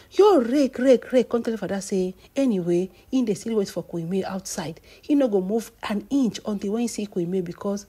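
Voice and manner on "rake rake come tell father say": ordinary talk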